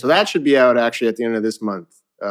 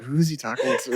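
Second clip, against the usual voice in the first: softer, laughing mutter; puzzled aside; 3 semitones higher, 7 dB quieter than usual. Retold or imitated. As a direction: mutter it like a confused bystander. Bystander's puzzled remark retold.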